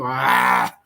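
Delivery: short mock-frustrated "aah"; exasperated yell. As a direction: give up with a loud, comic cry. Exasperated by confusing runner-up naming.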